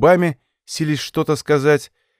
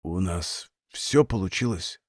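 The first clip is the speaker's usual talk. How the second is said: deathbed whisper; 8 semitones lower, 9 dB quieter, slower than usual. Acted. Dying man asks last question.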